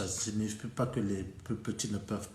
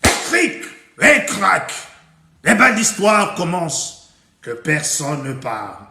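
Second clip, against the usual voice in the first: loud, high ritual call to the audience; big ringing story opener, easing down at the end; storyteller's opening call; 6 semitones higher, 14 dB louder than usual. Acted. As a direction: summon listeners to a tale at full voice. Calls the tale to begin.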